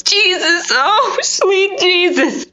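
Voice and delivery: ordinary delivery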